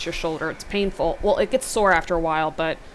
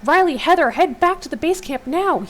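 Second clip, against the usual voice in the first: high, loud commands; yelling orders into the radio; 9 semitones higher, 8 dB louder than usual. A narrator's reading. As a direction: shout orders over the radio. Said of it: "you yell".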